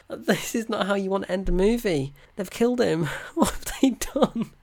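high, distraught protest breaking into a laugh; mock upset protest. Film fan reliving apparent death, mock distraught. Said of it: "it's so sad because I'm thinking".

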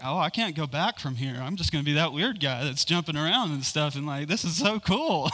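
bursting with delight at the discovery; joyful excitement. Retold or imitated. Retells joyful moment in worship.

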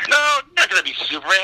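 high, quick mock outburst; imitating an exasperated director; exasperated correction. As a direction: cut him off with a high, rapid denial. Director correcting him in acted quarrel.

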